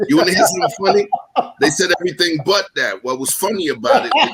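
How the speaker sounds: talks high and fast through laughter; laughing. Real, not acted. Moderator laughs telling a funny story, fast and high. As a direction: rush it out while laughing.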